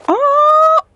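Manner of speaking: loud, very high, drawn-out "oh"; high squeal. Real, not acted. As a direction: squeal at the surprise. Reaction to another item.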